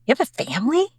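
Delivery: incredulous little-boy question, retold; imitated first grader. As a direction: lightly voice a small boy's surprise. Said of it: "And he goes".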